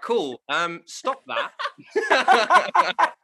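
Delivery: laughing. Retold or imitated. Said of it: "And they were like".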